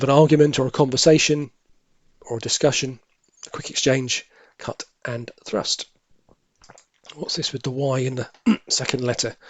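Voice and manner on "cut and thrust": a slow private whisper of "cut and thrust"; whispered to himself. Real, not acted. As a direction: whisper "cut and thrust" slowly under the breath. Repeating the answer to himself.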